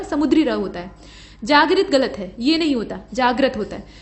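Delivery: ordinary talk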